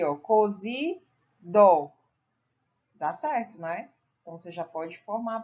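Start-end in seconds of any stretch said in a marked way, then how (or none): 0.2-1.9 "cozidó" drawn out slowly, with a pause before "dó"; syllable sounded out. Shown as a demonstration. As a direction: fit the last syllable onto "cozidó" after a gap. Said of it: "vamos tentar outra aqui".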